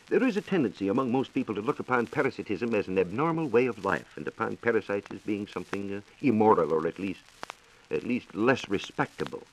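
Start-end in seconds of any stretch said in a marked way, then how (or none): none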